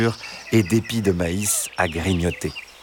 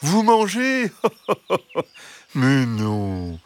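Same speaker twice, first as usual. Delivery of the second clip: amused innocent fox; 5 semitones higher than usual. A narrator's reading. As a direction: laugh off the accusation as a sly fox. Fox laughs off the hens' accusation.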